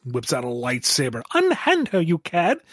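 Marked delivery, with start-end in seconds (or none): 1.3-2.6 high, loud melodramatic hero; hammy stage actor. Acted. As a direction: ham it up like an old swashbuckler. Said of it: "Orbot gets all big of himself".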